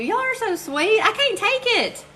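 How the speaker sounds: very high-pitched squeal of gratitude; overwhelmed, touched. Real, not acted. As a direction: gush high, overcome by their kindness. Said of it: "Y'all make me emotional".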